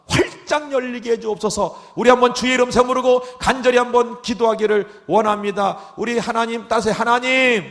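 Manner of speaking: loud, slow, drawn-out pulpit prayer; fervent preaching prayer. Real, not acted. Pastor leading the congregation into prayer, loud and slow. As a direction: pray at full force, stretching each phrase.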